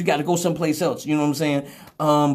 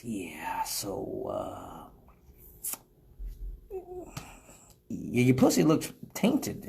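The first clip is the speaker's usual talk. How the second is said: much softer, near-whispered, slow; hushed confession; 9 semitones lower, 8 dB quieter, much slower than usual. Retold or imitated. He tells her the bad news in a hushed, reluctant voice. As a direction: break the bad news under your breath.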